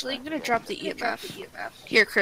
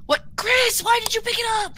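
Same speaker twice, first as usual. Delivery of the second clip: loud, startled accusing shout; 11 semitones higher, 6 dB louder than usual. Real, not acted. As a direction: yell it at your teammate. Teammate grabbed the skull.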